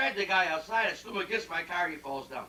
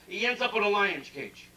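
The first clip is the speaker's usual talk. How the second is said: ordinary delivery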